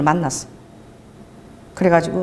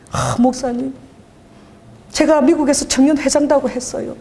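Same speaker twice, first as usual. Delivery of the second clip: ordinary talk, nothing marked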